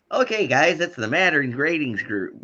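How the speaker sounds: lightly voiced announcer; imitating an announcer. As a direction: play the cheery ratings host. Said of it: "where he imitates".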